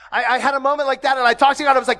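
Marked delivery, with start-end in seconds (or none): none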